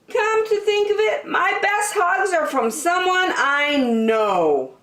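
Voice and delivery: put-on musing happily as young Douglas; high dreamy bear voice; Douglas's voice. Douglas realising.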